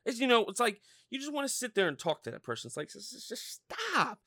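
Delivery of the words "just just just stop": high, slow exasperated pleading; scolding a child. Acted. Talking to that person like a child, exasperated scolding. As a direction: draw it out, as if to a misbehaving child. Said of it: "It's like talking to a child".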